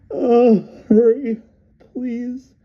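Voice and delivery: very high, loud, breaking plea; woman begging her doctor to hurry; desperate begging. A narrator's reading. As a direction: beg him to hurry, voice cracking. Polly begging, after dry sob.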